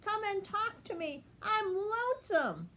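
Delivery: high, plaintive rabbit voice; lonesome little rabbit. A narrator's reading. Betsy the rabbit begs Penny to stay, high and plaintive. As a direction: plead for company, high and small. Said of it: "she cried".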